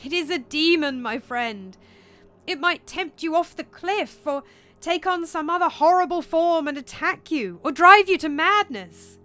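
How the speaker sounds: high, loud, building plea; alarmed voice, mounting in force; desperate warning. Acted. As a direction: plead urgently, building to a shout. Horatio desperately warns Hamlet.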